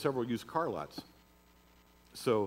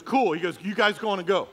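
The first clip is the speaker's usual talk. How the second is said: high, loud mock thug voice; big guy backing off; 8 semitones higher, 10 dB louder than usual. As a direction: play the thug giving up.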